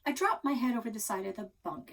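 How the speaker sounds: ordinary delivery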